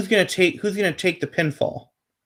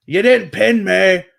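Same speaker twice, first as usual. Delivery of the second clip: a mock Newcastle English accent, pitched higher; Pac's Newcastle accent; 4 semitones higher than usual. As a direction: put on a Geordie English accent for the taunt. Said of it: "that wasn't bad".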